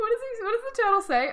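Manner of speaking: rushing to the best bit of the story; excited. Real, not acted. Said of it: "excited storytelling".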